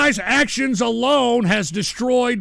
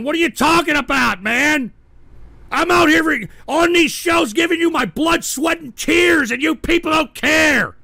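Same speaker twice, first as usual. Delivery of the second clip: higher, louder ranting shout; angry rant; 6 semitones higher, 4 dB louder than usual. Real, not acted. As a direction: vent your hurt at the chat. Ranting at the chat.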